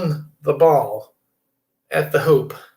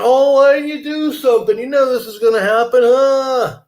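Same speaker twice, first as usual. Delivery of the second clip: much higher, louder whiny complaint; mock outraged public; 9 semitones higher, 5 dB louder than usual. Host mocking critics screaming at the president, hammed-up. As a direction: ham up the indignant critics. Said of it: "they'd be screaming".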